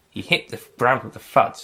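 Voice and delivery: plain reading voice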